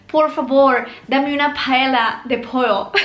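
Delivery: a light mock American accent in Spanish; imitating an American accent, slowed and amused. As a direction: play an American tourist ordering, lightly. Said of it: "Pronuncian tal que así".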